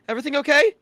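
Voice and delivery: loud, high-pitched check-in call; Hamilcar. Acted. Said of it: "Hamilcar pokes his head out, like".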